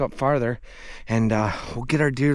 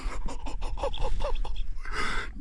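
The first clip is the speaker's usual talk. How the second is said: breathy disbelieving laugh. Real, not acted. Disbelief after the shot.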